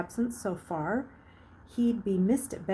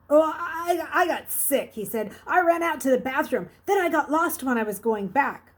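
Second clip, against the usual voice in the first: put-on higher, louder, feigned sick boy; playing dumb; 7 semitones higher, 6 dB louder than usual. A narrator's reading. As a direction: act dumb and queasy. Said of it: "act dumb enough and queasy enough".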